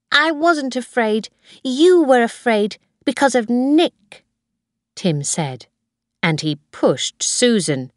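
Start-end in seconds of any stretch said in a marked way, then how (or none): 0.1-4.2 higher-voiced boy, protesting; Tim. A narrator's reading. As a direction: contradict your sister like a small boy. Small boy contradicts his sister.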